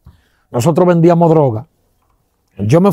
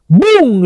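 mimicked crash of a machete on metal; bang noise; 8 semitones higher than usual. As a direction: slam the word out like a blow landing. Hitting the bus side.

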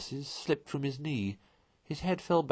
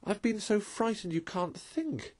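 frightened; 10 semitones higher, 4 dB quieter than usual. A narrator's reading. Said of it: "exhausted and still trembling".

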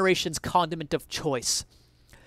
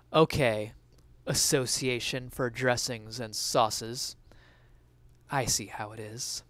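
lower, softer, slow dry sarcasm; sarcastic deadpan; 5 semitones lower, 6 dB quieter than usual. Acted. Essayist mocks the renamed mayo lobby, low slow deadpan. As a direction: say it with a dry eye-roll.

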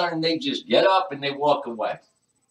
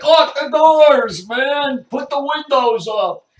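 drawn-out high alarmed yell; mock panicked shout; 10 semitones higher, 8 dB louder, slower than usual. People reacting to his hometown, mock panicked shout. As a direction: holler it in exaggerated panic.

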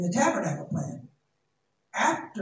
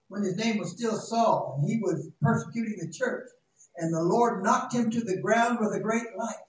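ordinary talk, nothing marked

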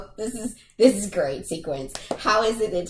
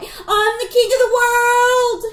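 much higher, louder, slow mock Titanic cry; triumphant shout; 8 dB louder than usual. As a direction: belt it from the top of the mast. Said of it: "He's up there like".

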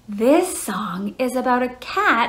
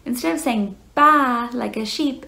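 ordinary delivery

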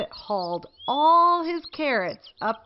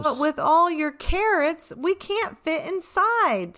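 higher, puzzled Beaver; confused protest; a bit bewildered; 7 semitones higher than usual. A narrator's reading. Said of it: "a bit bewildered".

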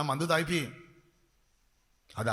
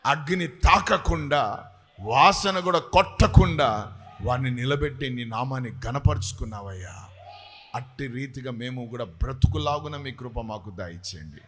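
calm closing prayer; 4 semitones lower than usual. Real, not acted. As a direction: settle the prayer down gently. Prayer settles to close.

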